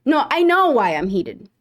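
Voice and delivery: normal talking voice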